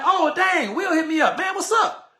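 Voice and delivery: high-pitched, eager imitation of a reply; excited reply. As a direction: act out a friend delighted to hear from you. Imagined friend's pleased reply to message.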